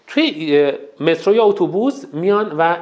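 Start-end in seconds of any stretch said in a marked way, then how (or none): none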